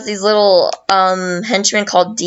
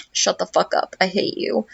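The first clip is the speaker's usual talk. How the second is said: dismissive deadpan; 6 dB quieter than usual. Retold or imitated. Loveby brushes off Peach's thanks, quietly dismissive. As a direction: drop the volume and dismiss her.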